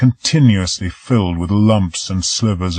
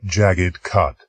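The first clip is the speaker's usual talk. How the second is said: ordinary delivery, nothing marked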